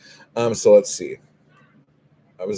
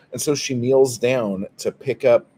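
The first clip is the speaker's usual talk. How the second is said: ordinary delivery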